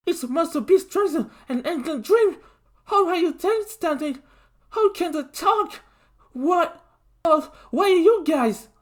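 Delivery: high, loud frantic questions; panicked shouting. A narrator's reading. Lincoln shouts panicked questions at the turtles. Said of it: "Lincoln shouted".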